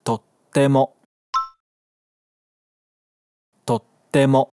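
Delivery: "Tottemo" with a short silent gap between "to" and "te"; geminate pause. Shown as a demonstration. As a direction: hold the gap before the t in "Tottemo". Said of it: "Fala aí". ("Tottemo" com pausa entre o to e o te)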